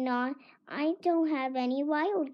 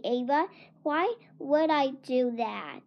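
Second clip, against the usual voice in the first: ordinary delivery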